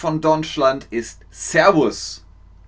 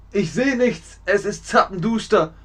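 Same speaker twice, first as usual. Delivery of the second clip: higher-pitched mock helplessness; groping in darkness; 7 semitones higher than usual. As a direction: pretend alarm at seeing nothing. Acts out being lost in darkness.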